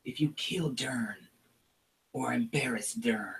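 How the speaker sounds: a sly shaman sharing a secret grudge; goblin shaman whisper. Acted.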